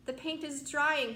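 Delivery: drawn-out complaint; moaning. Acted. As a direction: centipede moaning about the paint. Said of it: "he moaned".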